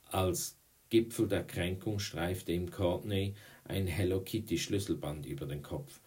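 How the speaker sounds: plain reading voice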